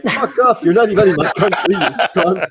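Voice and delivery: very high, loud cursing; mock furious insult. French speaker cursing out the clerk, mock furious. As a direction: blow up at them in a squawked-up retold outburst. Acted quarrel over language, swearing.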